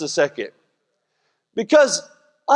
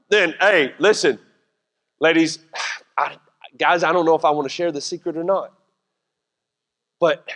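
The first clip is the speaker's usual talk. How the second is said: ordinary talk, nothing marked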